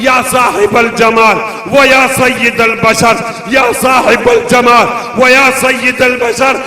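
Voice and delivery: loud, ringing sermon recitation; preaching at full force. Acted. Preacher reciting Arabic praise verses to the gathering, full-voiced. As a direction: declaim the verses to the crowd.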